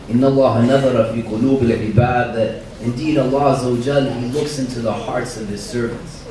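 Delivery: usual speaking voice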